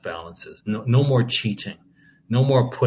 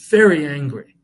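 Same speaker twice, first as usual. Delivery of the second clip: 1 emphatic anger; 5 semitones higher, 8 dB louder than usual. Real, not acted. Preacher urging outrage.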